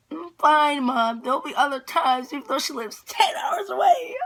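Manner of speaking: high, loud wail building in pitch; hammed-up tearful whine rising to a wail; retold near-tears. Daughter to mom about missing the meetup, tearful wail. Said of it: "about to cry".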